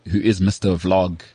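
ordinary delivery

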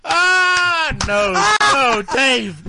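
high, loud laughing no; laughing protest. Real, not acted. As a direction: shout no through laughter. Reacting to a joke.

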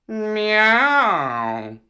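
miaow like a cat, swooping down; cat's meow. Acted. Cat answers the dog serving fish, meowing. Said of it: "he said".

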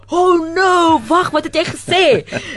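high, loud delighted outburst in Afrikaans; thrilled to hear Afrikaans spoken abroad; excited Afrikaans greeting. Retold or imitated. Comedian abroad hearing Afrikaans, delighted loud cry. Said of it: "you're like".

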